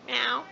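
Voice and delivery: high, loud cartoon meow; a quick loud mock meow; imitated cat meow. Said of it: "Simon's cat".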